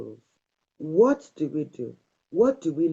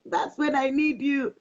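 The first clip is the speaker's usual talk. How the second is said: high, lightly whiny mock plea; mock whine; 11 semitones higher than usual. Wife answering her husband, a high mock whine. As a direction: play the needy wife half-jokingly. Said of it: "I'm like".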